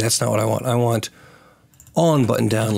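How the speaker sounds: normal talking voice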